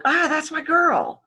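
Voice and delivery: high-pitched, delighted exclamation; acted burst of recognition. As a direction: greet your favorite person with a high, happy cry. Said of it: "Now you can go".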